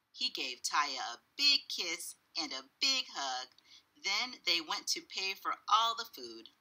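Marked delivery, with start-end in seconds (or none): none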